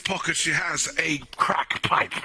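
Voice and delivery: nature documentary. Pretend. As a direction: hush as if observing a shy animal. Documentary narration continues.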